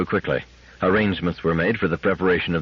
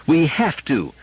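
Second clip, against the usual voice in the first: firm, raised insistence; unshaken, higher-pitched insistence; 8 semitones higher, slower than usual. Acted. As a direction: refuse to give in to her husband. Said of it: "was the unshaken response".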